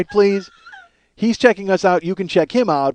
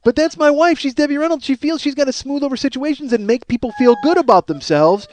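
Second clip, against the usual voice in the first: ordinary talk, nothing marked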